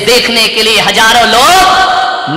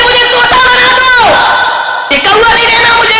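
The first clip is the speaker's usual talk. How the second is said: pleading crow; 12 semitones higher, faster than usual. A narrator's reading. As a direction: beg like a desperate crow. Crow begs saint. (ऊँची तेज़ आवाज़ में गिड़गिड़ाता कौवा)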